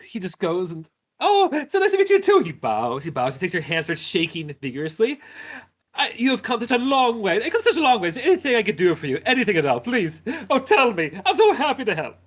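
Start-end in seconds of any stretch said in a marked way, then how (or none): 1.2-2.4 high, loud, giggly host; beaming mayor welcoming visitors; jolly mayor. Acted. Mayor answers warmly. 5.9-12.2 high, loud, giggly host; beaming mayor welcoming visitors; jolly mayor. Acted. Mayor fusses over guests.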